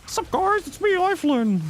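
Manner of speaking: giddy high creature voice gushing; high squeaky cartoon critter. Repeats character greeting.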